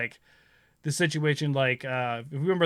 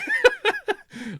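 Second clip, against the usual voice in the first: high cackling laugh; short high burst of laughing; laughter. Real, not acted. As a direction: laugh at your own remark. Laughing at own high school story.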